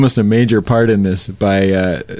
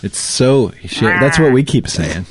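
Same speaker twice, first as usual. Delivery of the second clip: ordinary delivery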